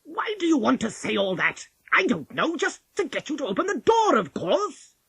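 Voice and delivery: loud, rising exasperation; cross wolf. A narrator's reading. Cross wolf loses patience with Polly.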